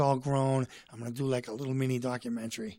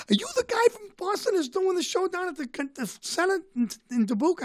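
much higher-pitched mock excited voice; excited stranger. Tour guide asks the visiting Boston comedian, imitated high and excited. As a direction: imitate an excited local recognizing someone.

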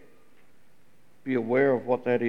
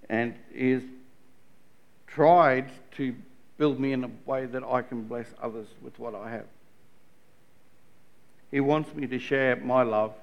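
normal talking voice

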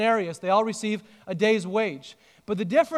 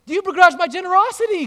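higher, louder, fast challenge with "begrudge" hit hard; God's voice confronting the grumblers, raised and quick; loud divine challenge; 8 semitones higher, 6 dB louder, faster than usual. Acted. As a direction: fire the question at the crowd. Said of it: "God says".

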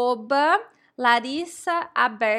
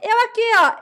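higher, louder hand-up "here I am"; roll call answer; 8 semitones higher, 7 dB louder than usual. Pretend. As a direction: pipe up like a pupil at roll call. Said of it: "Tipo chamada da escola".

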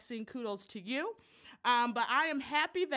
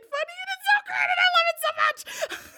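real gushing shout, much higher and louder; excited squeal; 10 dB louder, much faster than usual. Real, not acted. Host gushing over her funny friend, shouting. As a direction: squeal it like you can't contain yourself.

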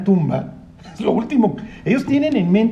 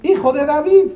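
blind man calling out; 8 semitones higher than usual. Retold or imitated. Blind man on the road calls to Jesus, a high pleading cry. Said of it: "un ciego en el camino que le grita".

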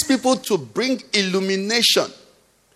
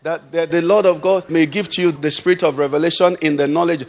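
usual speaking voice

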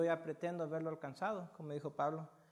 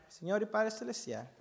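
prayer; 2 semitones lower than usual. Acted. Opening prayer. (oración pausada y reverente)

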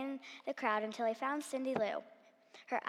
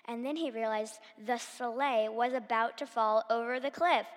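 plain reading voice

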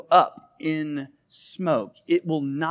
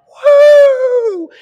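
high loud imitated crowd woo; mock crowd whoop; crowd cheer; 15 dB louder than usual. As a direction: cheer like a packed arena. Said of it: "And they're like".

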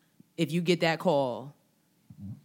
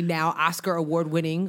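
ordinary talk, nothing marked

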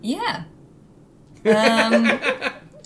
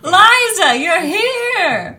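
excited greeting; 6 semitones higher, 7 dB louder, much slower than usual. Acted. Greets a surprise guest.

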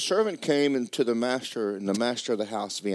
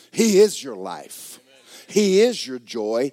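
slow, loud emphatic preaching; slow preaching declaration; 3 semitones higher, 6 dB louder, slower than usual. Acted. Preacher closing the point to the congregation, slow emphatic preaching. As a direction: pound each line home slowly.

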